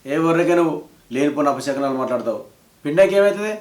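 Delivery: loud, sharp rebuke; angry scolding. Acted. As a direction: shut down the ominous talk. Father scolds mother for ominous talk.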